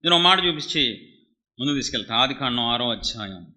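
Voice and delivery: loud, urgent Telugu sermon; fiery preaching. Acted. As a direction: preach it hard to the congregation. Preacher's sermon at full force.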